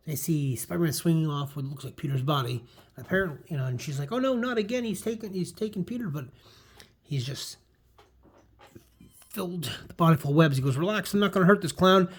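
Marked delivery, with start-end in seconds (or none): 4.1-6.1 put-on high panicked girl's voice. Gwen panicking over Peter. 10.8-12.1 loud, cocky hero line. Acted. Spider-Man confronting the crowd.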